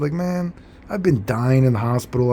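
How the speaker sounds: ordinary talk, nothing marked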